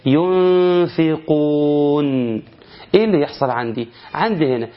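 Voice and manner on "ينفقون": very slow ينفقون with the nun hidden in a long nasal hum; slow tajweed recitation; Quranic recitation. Shown as a demonstration. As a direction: recite ينفقون, holding the ghunna before the F. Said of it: "نون ساكنة أتى بعدها حرف الفاء".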